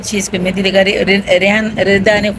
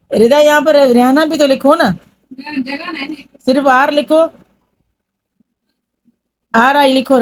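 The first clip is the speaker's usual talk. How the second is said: higher, raised call across the room; 6 semitones higher than usual. Real, not acted. She calls to Rida across the room, higher and raised. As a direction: call out to get her attention.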